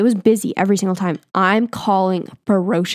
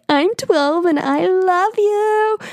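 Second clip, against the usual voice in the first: high, slow mock kid confession; imitating her younger self gushing; twelve-year-old fan; 11 semitones higher, slower than usual. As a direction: squeak it out like a starstruck kid. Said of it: "being like".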